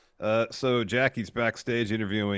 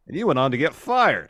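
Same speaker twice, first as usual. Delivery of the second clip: raised, louder punchline delivered with glee; gloating burn; 8 semitones higher, 8 dB louder than usual. Retold or imitated. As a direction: land the insult like a mic drop. Quoting Becky's burn on Nia.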